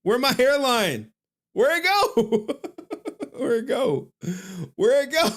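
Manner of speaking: high, loud comic disbelief; laughing, high-pitched outraged wail; mock alarm. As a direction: cry out in hammed-up panic, pitch soaring. Mock outrage at doctored picture of himself.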